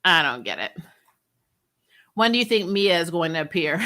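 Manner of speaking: usual speaking voice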